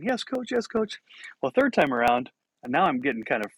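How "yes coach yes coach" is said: put-on high, meek kid replies; obedient young player. Boy answering his scolding coach, high and meek. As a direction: answer like a chastened boy, pitched way up. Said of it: "the coach is like".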